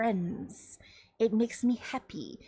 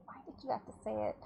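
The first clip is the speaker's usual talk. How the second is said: fast, soft embarrassed whisper; 10 dB quieter, much faster than usual. Real, not acted. Streamer regretting her own necromancer bit, hushed. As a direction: whisper it quickly behind a laugh. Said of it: "mock regret whisper".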